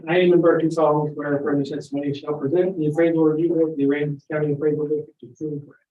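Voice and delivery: rushed oath reading. Real, not acted. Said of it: "You're gonna read the statement".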